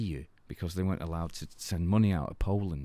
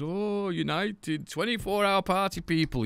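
high, drawn-out cry of recognition; excited taxi driver; 13 semitones higher than usual. Retold or imitated. Taxi driver greets a Manchester visitor, excited. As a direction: imitate a thrilled cabbie, pitch shooting up. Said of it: "and he goes".

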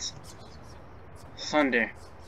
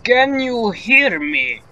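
much higher, louder, slow yell; 9 semitones higher, 9 dB louder, slower than usual. Acted. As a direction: yell it out slowly so she hears. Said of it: "I'm yelling".

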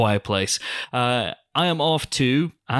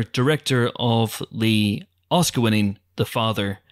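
usual speaking voice

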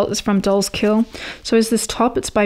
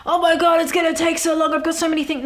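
mock panic; 8 semitones higher, 4 dB louder, faster than usual. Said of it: "I had in my head that it was gonna be this huge, like".

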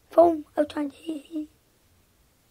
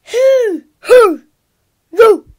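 shouting; 7 semitones higher, 13 dB louder, slower than usual. Pretend. Character shouting at brother.

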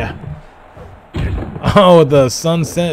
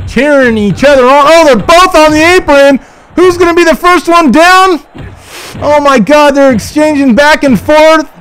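much higher and louder, rising to a yelled call; frantic play-by-play; 9 semitones higher, 5 dB louder than usual. Acted. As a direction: build the tension toward the final elimination. Play-by-play of final moments.

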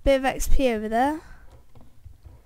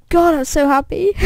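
ironic laugh; 6 semitones higher, 5 dB louder than usual. Real, not acted. Laughing at his own bad luck.

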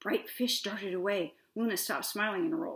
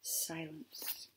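whispered, very soft single word; breath of suspense from the storyteller; hushed; 4 semitones lower, 11 dB quieter than usual. A narrator's reading. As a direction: let it fade into a whisper. Hush after the unanswered call.

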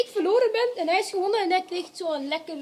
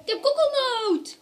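excited; 7 semitones higher, 4 dB louder, slower than usual. Real, not acted. Relieved to get the good flavour.

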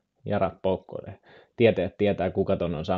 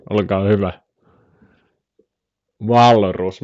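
normal talking voice

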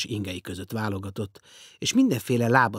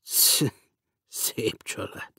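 grumbling; much slower than usual. A narrator's reading. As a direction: mutter it sourly to yourself. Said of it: "Dörmögte".

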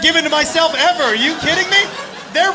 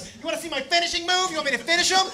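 higher, softer voice for her; woman's challenge; 5 semitones higher, 6 dB quieter, faster than usual. Acted. Mad woman asks the onlookers, high acted challenge. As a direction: offer it to the crowd in her raised voice. Acts woman addressing the crowd.

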